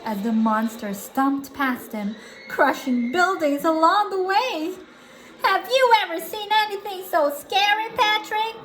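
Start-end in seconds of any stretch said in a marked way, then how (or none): 5.4-8.6 high, alarmed cartoon voice; crying out. SpongeBob hides from the monster with Patrick, crying out alarmed. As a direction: cry the question out high. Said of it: "SpongeBob cried".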